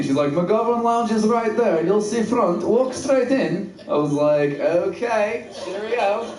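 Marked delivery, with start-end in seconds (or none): 0.3-3.7 high, slow mock Russian accent; Eastern European accent. Campus lady directs the driver, in an Eastern European accent. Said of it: "This lady's Eastern European". 4.6-6.3 high, badly faked British accent. Driver thanks the lady, still in a fake British accent. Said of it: "gonna use a British accent".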